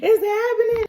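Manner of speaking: rising alarm, near a wail; child's panic peaking; panicked. Acted. Boy panicking.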